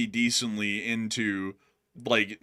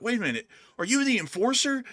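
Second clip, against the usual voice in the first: surprised fan; 11 semitones higher than usual. Retold or imitated. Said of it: "go out of their way to be like".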